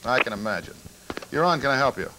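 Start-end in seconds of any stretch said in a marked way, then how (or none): none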